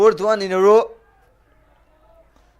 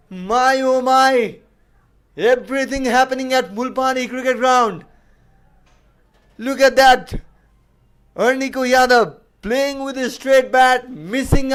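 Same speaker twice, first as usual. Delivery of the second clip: excited; 6 semitones higher, 5 dB louder than usual. Real, not acted. Crowd celebrating the hat-trick.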